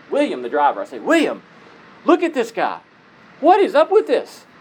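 surprised question from the back seat; startled call. Retold or imitated. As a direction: ask the driver what on earth this is. Calling to driver in surprise.

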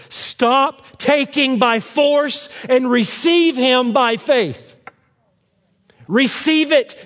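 high, ringing pulpit climax; impassioned preaching. Real, not acted. Preacher urges listeners to receive Christ, impassioned preaching.

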